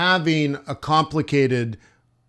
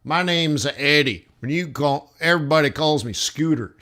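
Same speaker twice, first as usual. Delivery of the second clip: put-on Southern accent. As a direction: imitate a Southern local, not very well. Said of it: "I can't do the accent very well".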